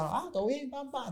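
high, breezy "todo bien, pam, pam"; a relaxed star greeting the gym crowd; friendly greeting. Retold or imitated. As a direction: toss off a cheerful hello to everyone. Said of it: "él le decía".

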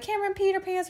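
high teasing schoolkid voice; a high mocking taunt from classmates. Classmates tease her after the spill, in a high mocking voice. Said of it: "everybody's looking at you like".

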